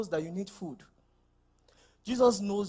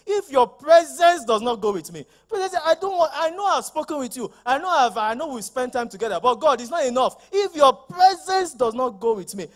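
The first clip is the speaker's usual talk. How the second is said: Moses pleading; 5 semitones higher, 7 dB louder than usual. Acted.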